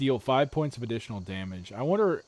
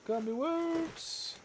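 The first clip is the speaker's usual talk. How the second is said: high, slow drawn-out sing-song; 7 dB quieter, much slower than usual. Real, not acted. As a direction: croon it like a little tune. Sing-song repeat of candy.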